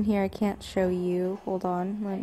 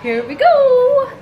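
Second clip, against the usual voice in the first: high, loud, slow cheer; excited shout; 13 dB louder, slower than usual. Real, not acted. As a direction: belt it out like a big cheer. Setting off, calling out.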